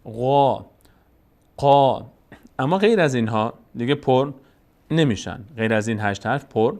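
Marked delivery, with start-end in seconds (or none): 0.0-2.0 "غا" and "قا" with full, back, long "aa"; heavy letters. Shown as a demonstration. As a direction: open upward on "غا", then "قا". («غا، قا» پر و کشیده)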